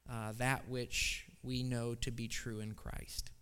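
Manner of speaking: soft, hushed prayer voice; hushed pastoral prayer. Real, not acted. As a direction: pray quietly and humbly before the congregation. Pastor leads congregational prayer.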